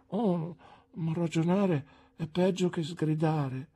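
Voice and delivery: desperate exclamation. A narrator's reading. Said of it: "esclamò disperata Dora".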